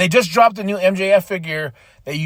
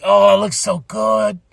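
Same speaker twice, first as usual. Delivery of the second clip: mock whining shopper; 5 semitones higher than usual. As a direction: beg your wallet like a greedy fan. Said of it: "and I'm like".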